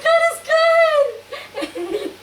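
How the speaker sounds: very high, drawn-out mock wail; too tearful to talk. Retelling being too overcome to talk, high wailing. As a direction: squeal it slowly as if sobbing for joy. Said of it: "And I couldn't talk".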